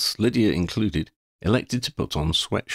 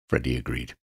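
normal talking voice

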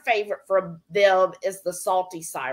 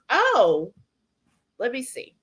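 ordinary talk, nothing marked

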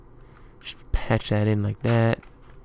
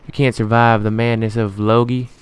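ordinary talk

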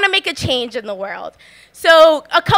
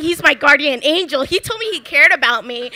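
ordinary delivery

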